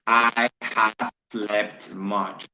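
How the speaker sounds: very slow, each word spaced out for learners; slow model sentence. Shown as a demonstration. Teacher slowly modelling an English sentence for students. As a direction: model it slowly so the class can follow. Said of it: "teacher models sentence".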